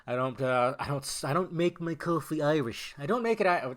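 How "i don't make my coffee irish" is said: botched attempt at an Irish accent; failed Irish accent. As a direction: try an Irish accent and miss it. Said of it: "that wasn't even an Irish accent".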